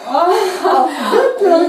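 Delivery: can't believe what she just heard; surprised. Real, not acted. Interviewer hears the poet wrote on bedsheets, incredulous.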